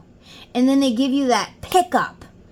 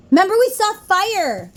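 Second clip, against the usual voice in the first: excited; 10 semitones higher, 4 dB louder than usual. Real, not acted. Recalling seeing fire, excited.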